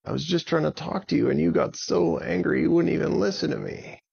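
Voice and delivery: high-pitched, earnest pleading; earnest upset friend. Acted. Avon asks dragon about his outburst, earnest pleading. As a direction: let the hurt pitch the voice up.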